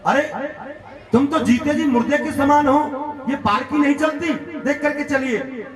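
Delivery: exasperated reprimand, acted without shouting; angry scolding. Furious king berates the bearer after another jolt, scolding. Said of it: "और डांट करके कहा".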